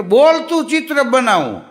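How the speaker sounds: bragging painter's pitch; boastful painter. Retold or imitated. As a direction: brag about the talking portrait. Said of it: "અરે મને ઈ કે".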